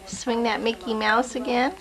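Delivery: ordinary talk, nothing marked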